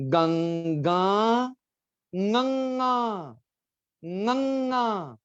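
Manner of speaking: गंगा as ŋəŋa, slow and nasal, voice in the nose; nasal sound. Shown as a demonstration. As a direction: hum गंगा through the nose, slowly. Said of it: "पूरी आवाज जो है वो नोज में". (गंगा नाक की आवाज़ में, धीरे)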